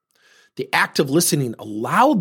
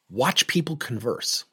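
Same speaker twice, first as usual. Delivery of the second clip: slow unvoiced whisper, drawing people in; 5 dB quieter, slower than usual. Shown as a demonstration. Coach urging listeners to watch, in a slow whisper. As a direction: breathe the words out slowly, almost secret.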